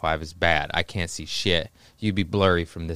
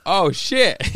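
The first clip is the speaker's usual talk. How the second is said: high-pitched retold "oh shit" of dawning alarm; alarmed realization; 10 semitones higher, 3 dB louder than usual. Man realizing police surround his bungalow, retold alarm. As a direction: reenact a startled realization, pitch jumping up.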